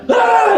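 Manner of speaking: high-pitched charging yell; mock battle cry. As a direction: let out a high attack scream.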